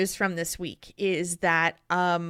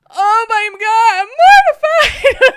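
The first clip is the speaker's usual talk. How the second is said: mortified cry; 14 semitones higher, 13 dB louder than usual. Retold or imitated. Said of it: "And I was like".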